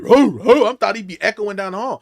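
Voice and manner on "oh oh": loud, high mock barking; imitated dog bark. Dog answering his owner down the hall, barking. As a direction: bark back like a big dog echoing. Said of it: "I'm used to hear".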